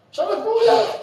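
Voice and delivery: high emphatic "מאולף"; high emphatic repeat. Shown as a demonstration. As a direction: push the point home, laughing. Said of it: "repeated for emphasis".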